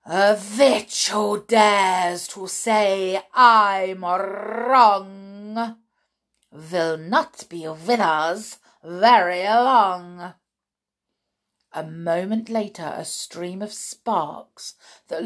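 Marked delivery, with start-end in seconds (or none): 0.0-10.3 put-on loud, drawn-out accented witch verse; terrible recital. A narrator's reading. Witch leader finishes the sentence, loud menacing recital. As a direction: declaim doom, rolling the R. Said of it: "with her terrible recital".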